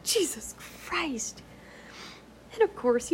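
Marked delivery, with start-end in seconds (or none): 0.0-1.4 whispered exclamation. Retold or imitated. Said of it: "I'm like".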